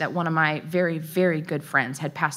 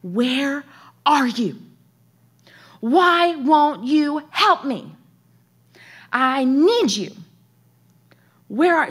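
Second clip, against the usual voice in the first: loud, raised cries with long gaps, imitating a child; retold scream; 7 semitones higher, 6 dB louder, slower than usual. Son screaming to the Lord upstairs, imitated loud cry. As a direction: cry out slowly, imitating a desperate plea. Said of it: "scream at the top of his lungs to the Lord".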